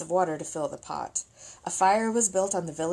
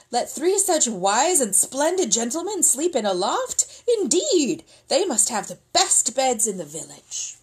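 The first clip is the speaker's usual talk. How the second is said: high, eager protest; flattering peasants; 6 semitones higher, 4 dB louder than usual. Acted. Peasants fussing over the soldiers, high and eager. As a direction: insist on the best beds.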